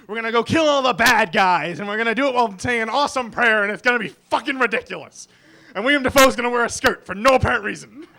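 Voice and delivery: loud, gung-ho movie pitch; hyped-up shouting. Acted. Fan summing up a favorite gangster movie, hyped-up shouting. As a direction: bellow it like an over-excited action hero.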